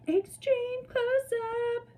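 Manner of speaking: very high, stretched-out sing-song; mock-dramatic high-pitched call; playful announcement. Playful call showing tortoise close up.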